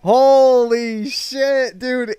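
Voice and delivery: high, loud, slow holy shit; amazed, almost shouting; stunned disbelief. Real, not acted. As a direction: drag it out in shock. Stunned by streamer's meltdown.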